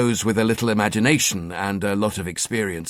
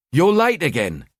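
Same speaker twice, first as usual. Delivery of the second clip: sharp, higher East End wife, accusing; accusing East End accent; 5 semitones higher than usual. Acted. Wife accusing husband of coming home late, East End accent. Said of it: "she accused in her East End accent".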